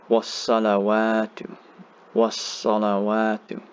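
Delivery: was-salawatu, sod held and long a stretched. Shown as a demonstration. Teacher showing two counts on the sod, was-salawatu drawn out. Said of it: "you hold the sod here for two counts".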